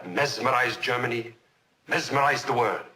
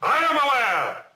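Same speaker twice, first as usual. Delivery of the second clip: loud insistence rising much higher on the repeat; defiant shout; 9 semitones higher, 6 dB louder than usual. Acted. Witness overriding his own counsel, defiant shout. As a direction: shout down the objection.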